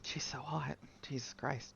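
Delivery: breathless whispered aside; whispering. Real, not acted. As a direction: whisper it to yourself, awestruck. Aside gushing over game character.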